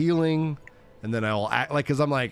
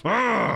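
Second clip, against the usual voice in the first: short high mock roar; sudden playful burst of aggression; high "Ah!" outburst; aggressive yell; 10 semitones higher than usual. Imitates his future aggressive outburst.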